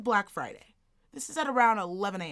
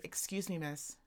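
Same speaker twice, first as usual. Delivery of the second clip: imitated timid request, much softer; soft polite approach; 5 semitones lower, 12 dB quieter than usual. Two women approaching a shopper in line, softly imitated. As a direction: drop right down, tentatively getting attention.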